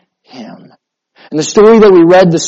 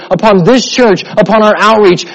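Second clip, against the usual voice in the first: normal talking voice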